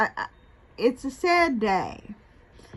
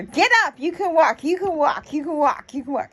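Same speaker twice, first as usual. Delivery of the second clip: higher-pitched mock command, repeated insistently; insistent officer's order; 4 semitones higher than usual. Police officer ordering an ill woman to stand, imitated insistent command. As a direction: imitate a pushy officer, high at first and easing down.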